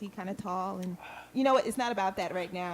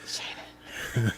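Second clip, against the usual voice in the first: whispered name, close to the mic; right in your ear; 12 semitones lower, 3 dB quieter than usual. Pretend. Whispering into the headphones.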